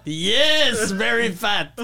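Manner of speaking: hammed-up French accent, drawn-out yes; French-accented English. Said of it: "two French people both speaking English poorly".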